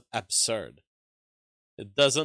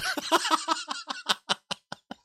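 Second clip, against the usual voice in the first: high burst of laughter. Real, not acted. Laughing at viewer's comment.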